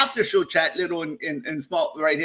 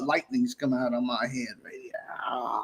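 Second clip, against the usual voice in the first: whispered; 4 semitones lower, 6 dB quieter than usual. Pretend. Whispered joke.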